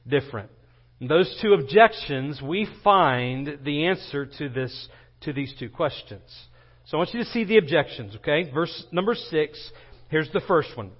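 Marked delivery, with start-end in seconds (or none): none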